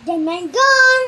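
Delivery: angry man shouting for his gun. Acted. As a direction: yell for a gun. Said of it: "the Joker shouting".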